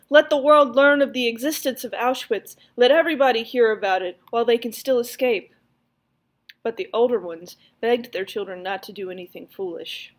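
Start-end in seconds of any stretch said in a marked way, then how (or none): 0.1-5.5 loud, higher, rousing call; rally the crowd with a loud cry; impassioned shout to the others. A narrator's reading. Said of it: "One of the young men cried".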